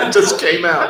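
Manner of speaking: laughing. Real, not acted. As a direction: let the laugh carry the words. Laughing at own accent.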